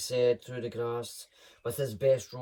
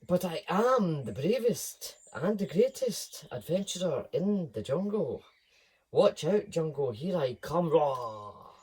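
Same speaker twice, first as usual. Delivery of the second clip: louder, higher brave boasting cub; boastful lion cub; 3 semitones higher, 5 dB louder than usual. Acted. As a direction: puff up like a proud cub.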